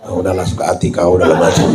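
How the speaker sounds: low, quick fed-up dismissal; exasperated giving up. Retold or imitated. Judge gives up on the groom, low quick exasperation. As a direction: toss it off low and fast, fed up.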